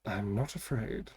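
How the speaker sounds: hushed, slow defiance; quiet resolve. Acted. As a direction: say it almost under the breath. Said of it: "this is for Siobhan".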